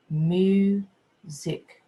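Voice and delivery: "Music" bounced, mu then sic; syllable bounce. Shown as a demonstration. Teacher leading the class syllable bounce. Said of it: "let's do our syllable bounce".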